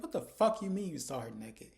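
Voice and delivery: loud, indignant comeback; retold outrage. She hears he saw Andy naked, mock outrage. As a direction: demand it loudly, acting out her outrage.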